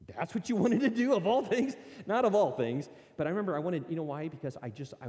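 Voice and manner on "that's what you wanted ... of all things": loud, high mock disbelief from a listener; mock incredulous question, louder and higher. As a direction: play the baffled listener, voice jumping up. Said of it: "You're like".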